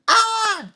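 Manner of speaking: sudden very high yelp; Joe Pesci yelp. Pretend. His Joe Pesci try, a very high yelp. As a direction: squeal it out in mock pain. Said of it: "That's my Joe Pe".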